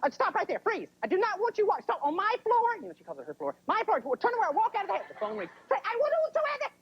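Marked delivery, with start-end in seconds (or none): 0.0-2.8 high, frantic mock scolding; angry mom. Mom catching grease on her floor, high angry scolding. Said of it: "She goes". 3.7-5.1 high-pitched mock tirade; angry mom. Mom ordering kid off her floor, high angry tirade. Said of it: "She goes". 5.7-6.7 very high mock-furious yell; mom mid-rant. Mom still yelling as phone rings, high fury. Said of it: "How do they do that mood change?".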